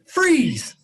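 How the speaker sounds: high, loud dramatic shout; exciting narrator. Pretend. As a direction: announce it like a thrilling narrator. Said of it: "I'm the exciting narrator".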